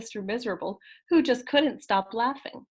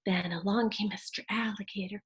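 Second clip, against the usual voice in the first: sneaky whisper; hushed alligator creeping; 5 dB quieter than usual. Acted. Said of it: "quiet as could be".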